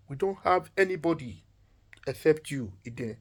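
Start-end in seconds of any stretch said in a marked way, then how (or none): none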